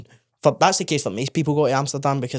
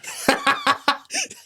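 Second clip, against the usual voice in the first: cracking up at his mate; big laugh. Real, not acted. Laughing hard at his mate's fourteen aftershaves.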